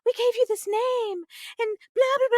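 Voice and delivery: high-pitched, dismayed mock mother; imitated mom. Mom answering child who wants a new name, high mock dismay. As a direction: plead it high, like a wounded parent. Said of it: "She's like".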